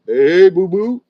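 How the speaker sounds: booming cartoon bear greeting his pal; hammed-up cartoon bear hello; Yogi Bear. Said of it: "But Yogi".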